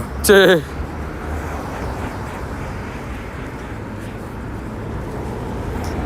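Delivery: silent breathy wheeze of laughter; long wheezing laugh at himself. Real, not acted. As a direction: laugh with barely any voice. Laughs at missed throw.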